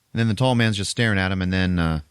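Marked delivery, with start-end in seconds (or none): none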